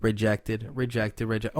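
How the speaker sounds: low soft repeated stamp; flat repeated verdict. Acted. Repeated stamp, softer each time.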